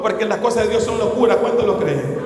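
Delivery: normal talking voice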